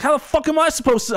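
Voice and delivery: high, exasperated protest; exasperated retort. Retold or imitated. Retold protest at the pushy driver, high and exasperated. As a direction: pitch it up in disbelief. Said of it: "I was like".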